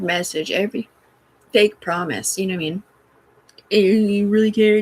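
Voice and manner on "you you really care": sarcastic; mock caring voice. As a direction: mimic the fake concern sneeringly. Mocking someone's fake concern.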